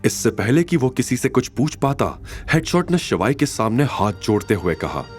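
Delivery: normal talking voice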